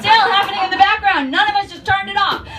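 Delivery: loud, high comic outrage; exasperated shout. Retold or imitated. Storyteller on music nobody turned off, exasperated shout. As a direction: shout it in disbelief.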